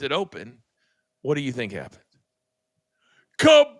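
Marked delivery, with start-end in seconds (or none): 3.4-3.7 loud vocal explosion noise; shouted kaboom explosion. Retold or imitated. Telling how the can burst on the boss, shouted kaboom. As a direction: blow it up with your voice. Red Bull explodes in face.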